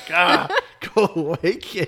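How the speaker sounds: loud, drawn-out mock brush-off; mock annoyed parent. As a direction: shoo the kid away, laughing. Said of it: "You're like".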